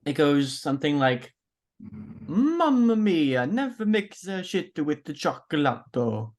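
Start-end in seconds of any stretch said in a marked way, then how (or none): 1.8-6.3 put-on slow, drawn-out Italian accent. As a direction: stretch it out like a hammy Italian chef. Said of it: "the best Italian accent".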